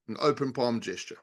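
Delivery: ordinary talk, nothing marked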